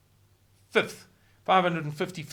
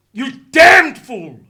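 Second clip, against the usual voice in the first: slow, much louder contemptuous roar; furious shout; 9 semitones higher, 19 dB louder, slower than usual. Acted. Speaker cursing an imagined leader, a slow furious roar. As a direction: spit out the insult at full volume, drawn out. Climax of rant at imagined official.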